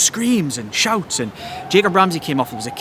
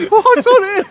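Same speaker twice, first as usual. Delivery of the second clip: baffled player; 7 dB louder than usual. Retold or imitated. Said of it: "looking at him like".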